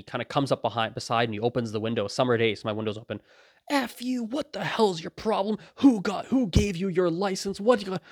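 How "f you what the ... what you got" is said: high, worked-up mock road rage; imitated angry cussing. Man cussing out a driver in a parking lot, imitated anger. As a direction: imitate a furious driver, pitched up but not really shouting. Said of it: "He's cussing me out hardcore".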